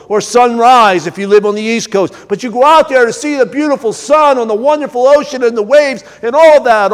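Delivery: normal talking voice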